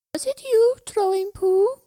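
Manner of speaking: put-on very high, loud child's question. A narrator's reading. Child in a bush questions the rock thrower, high voice. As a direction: question a stranger as an accusing child. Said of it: "the voice was definitely a child's".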